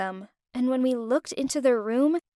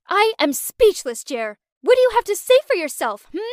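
high, loud and fast demand; screaming; 6 semitones higher, 4 dB louder than usual. Acted. Naomi screaming at Jeremy, high and loud. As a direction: scream at him for answers. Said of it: "Naomi screamed".

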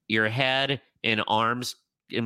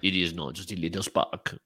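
put-on French accent; 6 semitones lower than usual. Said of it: "Pepe Le Pew".

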